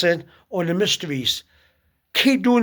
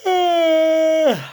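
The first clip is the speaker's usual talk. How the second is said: big yawning sigh pitched much higher and louder; 10 semitones higher, 4 dB louder than usual. Shown as a demonstration. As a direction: yawn out loud, wide and long. Shows yawning during prayer.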